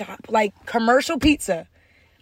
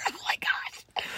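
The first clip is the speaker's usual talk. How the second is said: whisper; 9 dB quieter than usual. Pretend. After the verdict.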